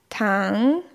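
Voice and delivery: Tang dipping low, then rising; third tone. Shown as a demonstration. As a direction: sink Tang down and bring it back up. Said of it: "sich hinlegen". (Tang im dritten Ton, fallend-steigend)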